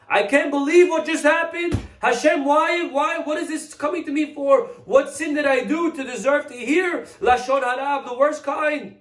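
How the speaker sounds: a rabbi wailing in dismay at hearing gossip; anguished outburst. Acted. Rabbi protesting to Heaven, anguished high shout. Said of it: "turning red in the face".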